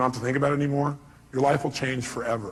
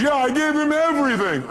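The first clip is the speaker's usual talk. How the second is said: hammed-up pleading caller; caller's voice; 13 semitones higher, 6 dB louder than usual. Caller pleading with Robbins on radio, high whine. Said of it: "He goes".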